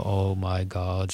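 an Irish viewer wincing at a bad accent; groaning Oh my God.

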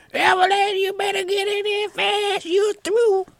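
very high, loud imitated scream. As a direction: scream for someone at the top of your range. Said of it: "the guy started screaming".

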